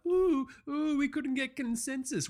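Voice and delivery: very high wimpy mimicry; mocking whine. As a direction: mimic a helpless excuse in a squeaky-high voice. Mocks players association's excuse.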